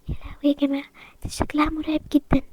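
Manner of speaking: hushed gasp of fear; scared whisper. Acted. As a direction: whisper in shock behind her back. Whispering about the scary new girl.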